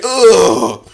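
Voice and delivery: a loud, strained grunt; mock grunt. Girlfriend grabbing his head, loud grunt.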